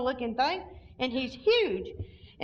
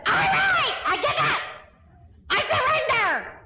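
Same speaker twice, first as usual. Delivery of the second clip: shouted surrender; 11 semitones higher than usual. Retold or imitated. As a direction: throw up your hands and yell it out. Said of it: "So I said".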